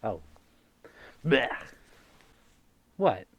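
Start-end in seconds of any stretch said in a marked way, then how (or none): 1.2-1.5 loud gagging blech of disgust. Real, not acted. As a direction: spit out a grossed-out blech. Disgusted reaction to something on face.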